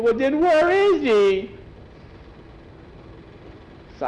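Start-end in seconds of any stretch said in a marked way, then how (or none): 0.0-1.5 put-on worried boy. Skeeter demands where Jackie is.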